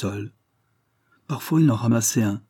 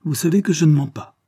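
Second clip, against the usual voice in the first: put-on higher, louder boyish protest; crying out; 6 semitones higher, 5 dB louder than usual. A narrator's reading. Accused pupil appealing to his tutor, crying out. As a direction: cry out to be believed. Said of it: "s'écria mon élève en m'apercevant".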